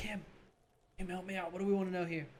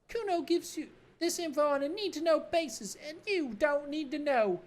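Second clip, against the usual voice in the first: put-on bratty kid Kuno; 11 semitones higher than usual. Cocky kid mocking the cop, in a high bratty voice. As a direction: sneer it like a cocky street kid.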